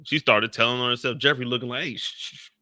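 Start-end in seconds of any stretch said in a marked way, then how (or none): 1.8-2.5 conspiratorial whispered shushing; mock hushing whisper. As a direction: hush her in a whisper. [name] hushing her secrets.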